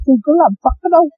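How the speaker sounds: high, fast protesting question; indignant retort. Retold or imitated. Monk retells answering the priest, high and quick. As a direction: toss it back, quick and high. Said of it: "Tôi nói".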